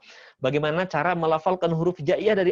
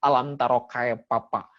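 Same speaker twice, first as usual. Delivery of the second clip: slow mock Sundanese "kaipapa", p instead of f; 4 semitones lower than usual. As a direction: say "kaipapa" slowly, Sundanese style. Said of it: "orang Sunda mah gitu kan". (logat Sunda, "kaipapa" dibaca pelan)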